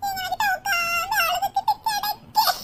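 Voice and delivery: pleading through sobs, pitch climbing; soft, crying voice breaking into high wails; tearful. Acted. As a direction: explain yourself to a son while weeping. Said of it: "அதான் அழுகழுகையா".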